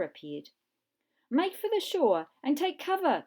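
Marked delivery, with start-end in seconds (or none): 1.3-3.2 loud, high urgent command; cried out. Acted. Professor ordering the children to shore, crying out. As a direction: urge them to run for cover. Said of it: "cried the professor".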